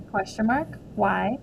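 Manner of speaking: ordinary delivery, nothing marked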